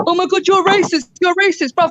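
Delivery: high whiny mocking accuser.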